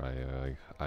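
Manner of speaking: normal talking voice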